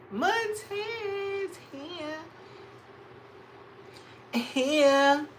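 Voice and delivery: high, very slow drawn-out call; singsong greeting to a viewer; sing-song call. Acted. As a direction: stretch the name out like a singsong call. Calling out a viewer's name.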